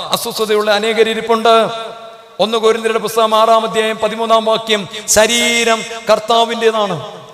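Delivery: rising fervour of a revival sermon; impassioned preaching. Acted. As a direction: preach it out to the whole church. Preaching at full force.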